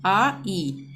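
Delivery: normal talking voice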